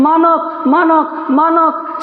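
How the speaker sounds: high calling cry to her son. Acted. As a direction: call out to the son in a dream. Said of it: "चिल्ला रही है।". (ऊँची आवाज़ में बेटे को पुकारना)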